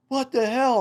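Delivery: imitated high, loud shocked yell; shocked outburst. Shaken dad snaps at son after near fall, high shocked yell. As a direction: pitch shoots up in mock outrage.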